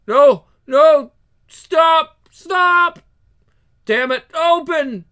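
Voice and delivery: loud, high, desperate yelling; panicked shouting. Acted. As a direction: scream for them to stop. Trapped as door seals.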